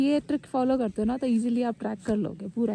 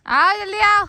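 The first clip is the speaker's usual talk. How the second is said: high, loud call to the friends behind; urging the stragglers on with a high yell; 9 semitones higher, 8 dB louder than usual. Real, not acted. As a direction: shout up the trail for them to hurry. Calling friends to hurry up.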